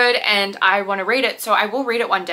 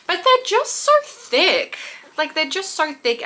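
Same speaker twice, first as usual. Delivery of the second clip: high, slow, stretched so thick; playful whine over fat books; mock dismay; 6 semitones higher than usual. Real, not acted. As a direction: wail about how thick they are. Exaggerated complaint about thickness.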